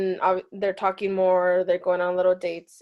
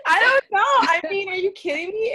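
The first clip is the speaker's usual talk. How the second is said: high, loud laughing outburst; 12 semitones higher, 6 dB louder than usual. Real, not acted. Joking with friends about a death twist, laughing loudly. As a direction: laugh through the words in disbelief.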